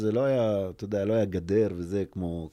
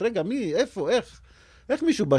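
confused disbelief; 12 semitones higher, 6 dB louder than usual. Retold or imitated. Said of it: "אתה כמו בסרט".

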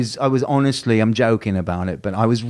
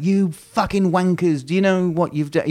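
higher-pitched imitated outburst; a hammed-up furious shout at the crew; mock yell; 7 semitones higher than usual. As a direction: yell it like losing your temper. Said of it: "I didn't wanna get, I wanted to get quiet".

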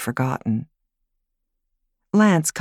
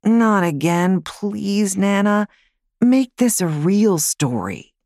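put-on a boy begging for a real story; whiny little boy; 6 semitones higher, slower than usual. Boy pleads against fairy tale.

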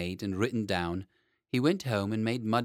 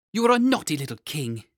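higher, louder nursemaid scolding; scolding nurse; 6 semitones higher, 8 dB louder than usual. A narrator's reading. Nurse putting the king to bed, scolding him. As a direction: tell him off sharply.

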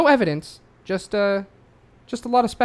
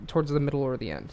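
ordinary talk, nothing marked

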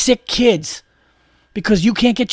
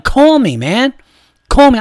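high, loud and exasperated; indignant, raised-voice demand; annoyed demand; 6 semitones higher, 5 dB louder, slower than usual. Acted. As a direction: punch it out like an irritated customer. Acts out annoyed demand to office.

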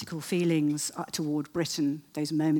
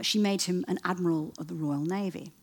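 normal talking voice

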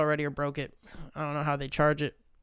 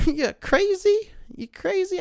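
very high, squeaky disbelieving protest; mock incredulity; 7 dB louder, slower than usual. Retold incredulous refusal to a request.

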